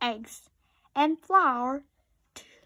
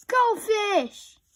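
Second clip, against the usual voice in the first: blaring; 9 semitones higher, 5 dB louder than usual. A narrator's reading. Robot waking loudly.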